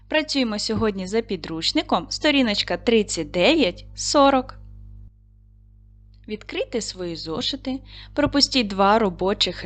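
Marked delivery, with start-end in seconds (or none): none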